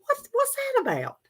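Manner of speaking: high incredulous squeal; can't believe the scale; indignant disbelief. Real, not acted. Vlogger reacting to a tiny weight loss, high and incredulous.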